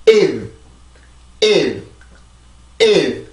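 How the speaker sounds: "일" with the final consonant attached after the vowel; stressed "일" closing on its end sound; ending sound added. Shown as a demonstration. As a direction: drill "일" as separate falling beats. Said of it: "이게 끝으로 가죠".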